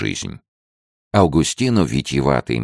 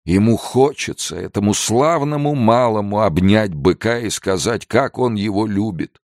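put-on high, maudlin drunken whimper; tearful explaining; 9 semitones higher than usual. A narrator's reading. Pedro explains the gendarme's love of bulls, tearfully. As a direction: explain it tenderly through drunken tears. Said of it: "проливая потоки слёз".

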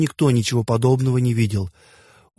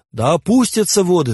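mild cry, raised in pitch; cried command; 6 semitones higher, 3 dB louder than usual. A narrator's reading. God commanding the waters to fall, understated high cry. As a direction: cry out the decree with restraint. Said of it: "вскричал бог".

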